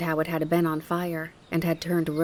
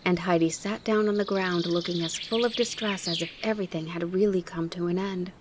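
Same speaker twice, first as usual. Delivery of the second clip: plain reading voice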